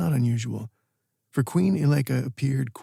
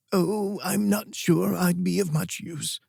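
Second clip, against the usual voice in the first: put-on higher, slow, hesitant old woman's voice; unsure old queen; 6 semitones higher than usual. Old queen unsure, gently declining.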